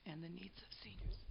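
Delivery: losing her voice, words barely voiced; raspy whisper. Real, not acted. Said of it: "I'm sorry, I'm losing my voice".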